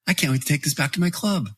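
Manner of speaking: imitating a giddy golf buddy; thrilled. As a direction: squeal it like a kid on Christmas. Buddy thrilled after making a birdie.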